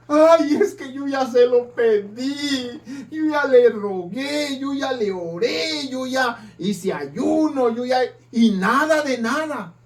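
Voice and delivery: hammed-up tearful lament; whiny complaint. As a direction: whimper like someone whose prayers failed. Mocking a whining believer.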